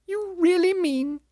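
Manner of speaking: very high, drawn-out disbelief; incredulous ghost. A narrator's reading. Ghost questioning the spirit, incredulous squeal. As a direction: stretch it out high, aghast.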